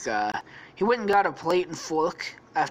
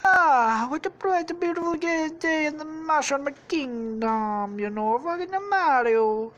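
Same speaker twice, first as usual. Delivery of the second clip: Mario; 12 semitones higher, 4 dB louder than usual. Acted. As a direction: hail the morning in a high put-on voice. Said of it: "wakes up and he's like".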